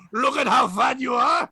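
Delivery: loud, high mock-French jeer; French accent. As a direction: exclaim it in a put-on French accent. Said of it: "in French, you could just do that".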